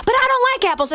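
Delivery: put-on whiny kid voice. Boy refuses the apple again.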